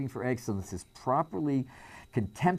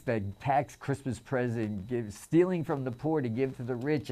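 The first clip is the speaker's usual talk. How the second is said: ordinary delivery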